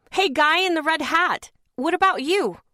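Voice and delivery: loud, high call across the street; shouted heckle. Retold or imitated. Woman yelling at a stranger across the street. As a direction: yell at the man in the red hat.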